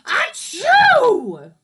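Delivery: huge, high, loud sneeze; giant sneeze. Acted. Wolf sneezing down the straw house, giant sneeze. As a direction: blast out an enormous sneeze. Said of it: "I sneezed a giant sneeze".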